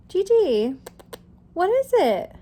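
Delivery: high-pitched pet talk; calling to the cat. Real, not acted. Calling to her meowing cat.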